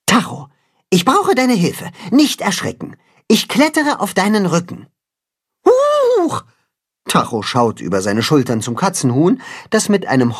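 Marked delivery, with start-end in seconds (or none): 0.1-4.9 much higher, gentle urgent creature voice; cat-chicken hero. Acted. 5.7-6.4 high squeaky startled "huch"; a jump of fright; startled yelp. Acted. Goat startled by rider.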